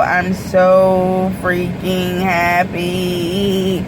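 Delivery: very slow, savouring every word; drawn-out happiness. Real, not acted. Vlogger to viewers about her GoPro, drawn-out joy. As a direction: stretch each word out in bliss. Gushing over new camera.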